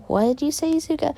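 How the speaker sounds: high, rising mother voice; questioning mother. Acted. Mother asks Izuka what she said, high and questioning. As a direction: lift the pitch right up into the question. Mother replies mid-hug.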